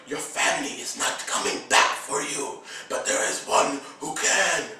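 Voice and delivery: soft breathy mysterious voice; quiet whispered prophecy; hushed Maz. Retold or imitated. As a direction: whisper it like a secret.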